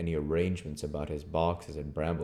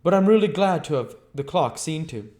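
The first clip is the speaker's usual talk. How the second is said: higher, curt stranger; stranger's voice; 11 semitones higher, 11 dB louder than usual. Acted. Stranger answering Henfrey, higher curt voice. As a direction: play the aloof stranger, pitched up.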